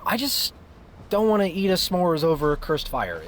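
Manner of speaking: loud, high-pitched objection; exasperated protest. Acted. Son refusing s'mores over a cursed fire, exasperated. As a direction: plead loudly, voice pushed up high.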